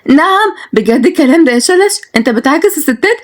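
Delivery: high, stunned outrage in a woman's voice; shocked. Acted. Nagia confronting Shalash about flirting with neighbors, shocked. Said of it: "جت صدمت نجية".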